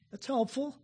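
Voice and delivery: ordinary delivery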